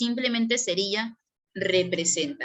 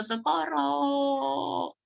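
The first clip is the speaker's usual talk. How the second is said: Socorro stretched very long and high, cried out; prolonged cry for help; 5 semitones higher, much slower than usual. Shown as a demonstration. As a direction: drag out Socorro as a long wail. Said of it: "si lo prolongas".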